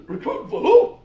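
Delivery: high, loud disbelief; incredulous. Retold or imitated. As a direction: fire it back in total disbelief. Pete incredulous at recruiting.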